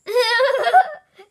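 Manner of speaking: high, loud giggle; giggling. A narrator's reading. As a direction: giggle like amused elephants.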